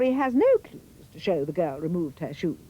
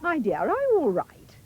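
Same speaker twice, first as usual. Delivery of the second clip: high, sweet fairy voice; fairy godmother; 8 semitones higher than usual. A narrator's reading. Fairy asks Cinderella if she's all right, high and sweet. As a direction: coo it like a kindly fairy. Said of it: "she said".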